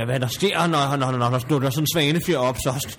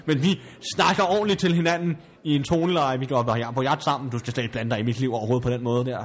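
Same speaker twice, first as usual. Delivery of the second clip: ordinary delivery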